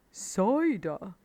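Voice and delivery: lightly voiced wise badger. A narrator's reading. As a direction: savour the word. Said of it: "said Badger".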